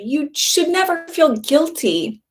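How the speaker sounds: usual speaking voice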